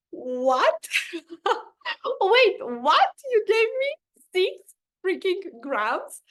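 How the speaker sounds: high, loud disbelieving squeal with a laugh; retold shock, voice jumping up in disbelief. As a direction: replay the moment you heard the dose, stunned. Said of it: "I was shocked".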